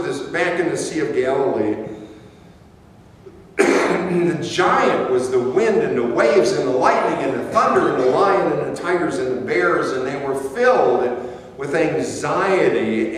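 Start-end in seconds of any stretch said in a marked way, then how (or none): none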